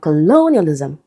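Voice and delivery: "colonialism" stressed on LO after a schwa; second-syllable stress. Shown as a demonstration. Teacher summing up the rule, second-syllable stress. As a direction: hit LO in "colonialism". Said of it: "letter O becomes a".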